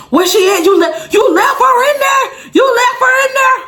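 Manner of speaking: mock alarmed shout. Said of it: "in here like".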